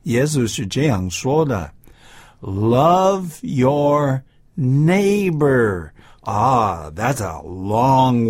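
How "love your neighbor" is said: very slow, drawn-out verse quote; slow quoted verse. Shown as a demonstration. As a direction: stretch out Love your neighbor as a lesson. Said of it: "but it says here".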